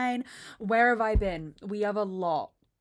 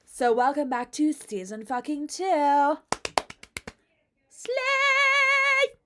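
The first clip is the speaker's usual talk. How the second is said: loud, slow build to a high sung "Slay!"; hyped announcement; 6 semitones higher, 6 dB louder, much slower than usual. Real, not acted. Vlogger welcoming viewers to season two, hyped announcement. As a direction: stretch out "two", then shriek-sing "Slay".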